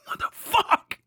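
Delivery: ordinary talk, nothing marked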